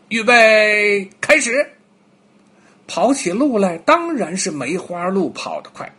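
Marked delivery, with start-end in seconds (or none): 0.1-1.7 starting call. Acted. Bear starts the race.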